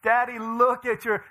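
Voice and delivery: put-on high, drawn-out child's teasing; loud mock-giggly squeal of words; laughing daughter. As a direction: imitate an amused little girl. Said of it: "and she's laughing".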